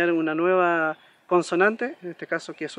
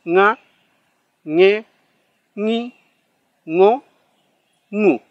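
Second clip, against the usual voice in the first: syllables with a nasal ng, louder; nasal drill; 2 semitones higher, 7 dB louder, much slower than usual. Shown as a demonstration. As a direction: drill nga, ñe, ñi, ngo, ngu through the nose. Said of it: "como gangoso".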